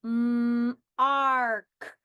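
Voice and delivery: M, then arc; sounds segmented. Shown as a demonstration. Teacher sounds out mark for spelling. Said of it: "I will say the sounds".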